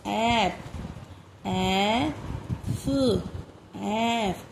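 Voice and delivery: slow, spaced-out dictation of short sounds; careful classroom dictation, one word at a time; phonics dictation. Shown as a demonstration. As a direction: read each word clearly for children to write. Teacher dictating phonics words.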